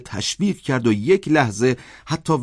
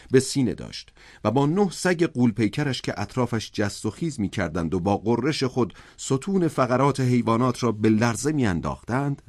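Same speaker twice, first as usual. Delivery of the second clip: ordinary delivery, nothing marked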